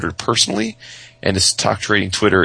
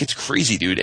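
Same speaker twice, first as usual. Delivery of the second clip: usual speaking voice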